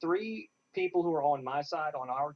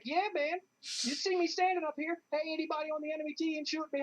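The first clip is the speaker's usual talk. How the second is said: much higher, cocky taunting call, fast at the end; wild and crazy; 11 semitones higher, faster than usual. Retold or imitated. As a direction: dare the whole enemy team to shoot you. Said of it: "I was just being wild and crazy and ridiculous".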